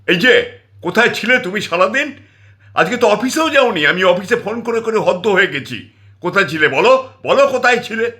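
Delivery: high, loud scolding yell; furious wife yelling at her husband at the door; shrill scream. Acted. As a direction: scream at the husband for vanishing all day. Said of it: "ওর স্ত্রী চিল চিৎকার করে উঠল".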